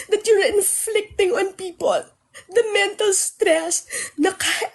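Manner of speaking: 高く張り詰めた声で泣きそうに訴える; pleading on the edge of crying, pitched high; voice breaking with tears. Real, not acted. Tearful rant to former friends.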